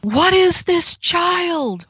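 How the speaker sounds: very high, slow imitated wail; retold scream. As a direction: draw it out high like a shocked shriek. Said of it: "she started screaming".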